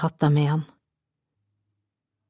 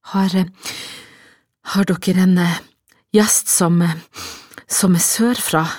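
halting, louder, broken by pauses; pressed out, breathless; 4 dB louder than usual. A narrator's reading. Winded woman asks innkeeper about a guest, pressing words out haltingly. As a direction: force the question out in stumbling bits. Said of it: "fikk hun omsider pressa frem".

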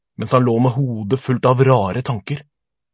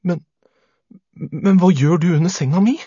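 high, louder, stammered question; uneasy child's higher voice; nervous boy; 7 semitones higher, 5 dB louder than usual. A narrator's reading. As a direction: falter on the first word, then ask anxiously. Scared boy questions monster under bed.

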